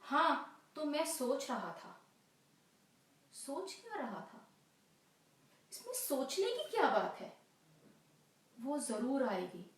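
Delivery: stage lover, wavering between confidence and brooding; restless waiting lover. Acted. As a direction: reassure yourself while counting the minutes.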